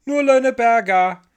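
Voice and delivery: put-on little girl yelling down; high, loud child's call; shouting back. A narrator's reading. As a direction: holler it from far up. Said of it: "schrie die kleine Ida zurück".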